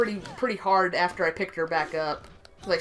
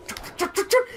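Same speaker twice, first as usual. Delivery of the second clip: quick unvoiced patter of cat feet; imitated running paws; 14 semitones higher than usual. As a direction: tap out little running footsteps with the mouth. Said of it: "and then you hear".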